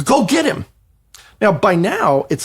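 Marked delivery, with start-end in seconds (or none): 0.0-0.6 high, pushy command; urging agent. Retold or imitated. Agent sending the writer after the teen, high. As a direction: shove him off with it.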